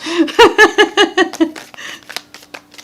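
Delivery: loud high burst of laughter. Real, not acted. Reader laughs loudly at another major card. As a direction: laugh out, delighted and amazed.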